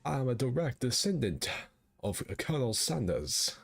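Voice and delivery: light southern gentleman voice; old southern gentleman. Acted. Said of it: "talk like an old southern slave owner".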